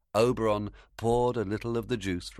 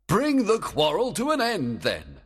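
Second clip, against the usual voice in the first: fairy king voice; 14 semitones higher, 6 dB louder than usual. Acted. Oberon, loud and high.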